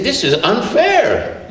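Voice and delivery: high, slow outraged protest; an eight-year-old crying foul; hurt, furious child; enraged kid. Retold or imitated.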